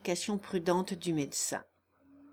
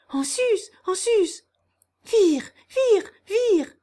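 much higher, loud shepherd's cries; calling the herd; 10 semitones higher, 9 dB louder than usual. A narrator's reading. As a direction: urge the dog on across the meadow. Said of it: "elle excite son chien".

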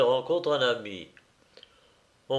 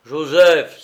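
loud, higher-pitched shout of a name; master's cry; 4 semitones higher, 12 dB louder than usual. A narrator's reading. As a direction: call out sharply to the servant. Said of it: "s'écria-t-il".

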